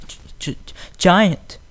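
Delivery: hushed stammer bursting into a loud cry; terrified stammer. A narrator's reading. Filly spotting the giant robot, stammering then crying out. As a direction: choke on it, then blurt it out. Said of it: "she stammered".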